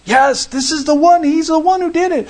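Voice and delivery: excited crowd. Acted. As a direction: point him out with rising excitement. Crowd voiced bearing witness.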